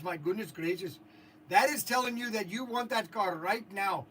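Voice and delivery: put-on Indian accent at ordinary volume; Indian-accented TikTok salesman. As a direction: keep the Indian accent going while showing off the car. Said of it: "I'm going to do my Indian accent for TikTok".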